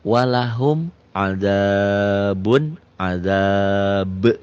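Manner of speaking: slow, chanted tajwid reading; Quran recitation. Shown as a demonstration. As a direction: recite it slowly with held vowels. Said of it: "Idhar itu tulisan dan bacaannya sama".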